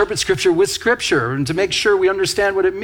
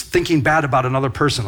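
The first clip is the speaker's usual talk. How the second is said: ordinary talk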